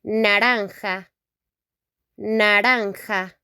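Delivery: Naranja with tapped r and a rasping j; teacher modelling Naranja; Spanish pronunciation. Shown as a demonstration. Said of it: "in Spanish".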